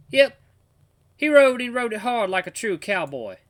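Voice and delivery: high, loud southern cowboy talk; southern accent. Acted. Grandpa inventing a death story as disguise, southern accent. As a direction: spin a tall tale in southern twang. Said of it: "I'm gonna keep doing the southern voice".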